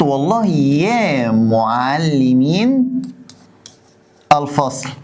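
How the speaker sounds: very slow, stretched words; teacher dictating an example; slow dictation. Shown as a demonstration. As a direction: draw out يا معلمين for writing. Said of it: "ايه ده يا معلمين الفصل؟".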